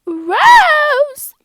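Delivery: a huge high scream of a name. Retold or imitated. Classmate screaming a friend's name in class, full-volume scream. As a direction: scream her name across the room. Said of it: "She's like".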